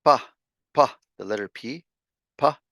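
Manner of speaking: "pa" with an aspirated p; p sound. Shown as a demonstration. Teacher showing how the p in "pa" sounds. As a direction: puff the p in "pa". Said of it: "more of that kind of sound".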